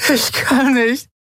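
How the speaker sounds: high, slow, broken-down cry; anguished despair. Acted. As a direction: give up in high-pitched anguish. Despairing captain collapsed, speaks aloud.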